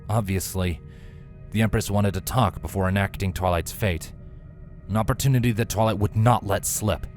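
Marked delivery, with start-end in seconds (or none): none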